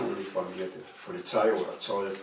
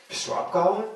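much higher, astonished asking; surprised question; 10 semitones higher, 4 dB louder than usual. Acted. Young man on the train asking the boy, astonished. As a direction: sound caught off guard.

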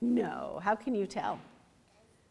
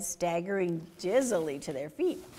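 usual speaking voice

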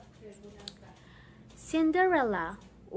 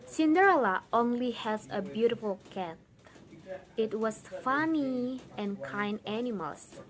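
ordinary delivery

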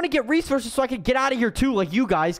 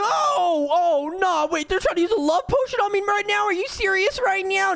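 high-pitched, alarmed disbelief; mock horror; 11 semitones higher than usual. Gamer overhears the love potion plot, horrified. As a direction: squeal in exaggerated dismay.

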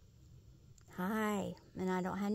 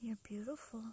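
hushed reassurance, barely voiced; soft whisper; 18 dB quieter than usual. Real, not acted. Whispering praise to a frightened wild animal. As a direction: whisper it gently so as not to startle.

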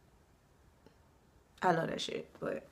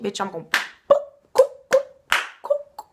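mock fight threat; 10 dB louder than usual. Warning people off her drink, squealing loud. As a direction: hype yourself into a squeaky mock brawl.

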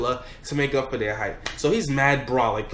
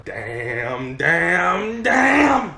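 rising pained yell; 3 semitones higher, 4 dB louder, much slower than usual. Retold or imitated. Getting stabbed with a pencil.

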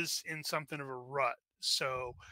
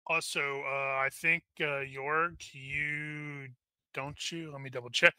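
ordinary talk, nothing marked

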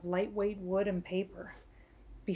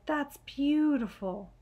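put-on child in awe; 6 semitones higher, slower than usual. Billy admiring the plane.

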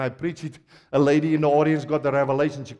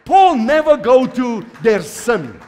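loud, higher preaching drive; full-force preaching; 6 semitones higher, 9 dB louder than usual. Real, not acted. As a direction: press the point home to the crowd.

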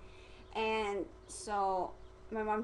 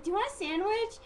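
high, casual mom voice offering food; lightly chirped question in a high voice; imitating mom; 12 semitones higher than usual. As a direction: pitch it up as an unsuspecting mom. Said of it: "she's like".

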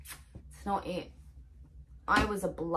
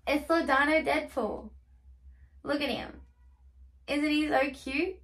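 high, gushing cute voice; gushing over cute toy; 7 semitones higher than usual. Real, not acted.

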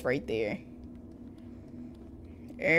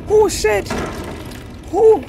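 high, loud burst of shock; real jump-scare cry; startled yelp; 12 semitones higher, 8 dB louder than usual. Real, not acted. Player startled by the game, sharp yelp.

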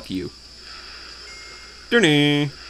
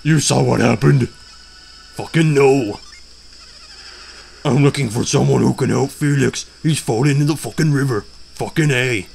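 mom voice; slower than usual. Acted. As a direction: play the mom with a heavy put-on voice. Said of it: "This will be my voice for the mom".

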